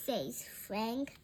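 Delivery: usual speaking voice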